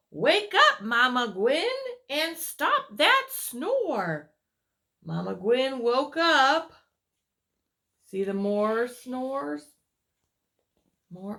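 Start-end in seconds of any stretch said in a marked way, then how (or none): 0.1-4.3 loud high wake-up call; sing-song call to wake him up. Acted. Character calls sleeper awake. 5.0-6.7 story narration. A narrator's reading. Reads picture book aloud.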